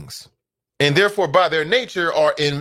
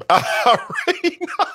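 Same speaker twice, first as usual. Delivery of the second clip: laughing; 6 semitones higher than usual. Real, not acted. Laughing at daughter story.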